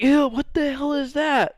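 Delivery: high, slow disgusted whine; squeaky disgust. Retold or imitated. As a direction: recoil in a high voice. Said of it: "Or like".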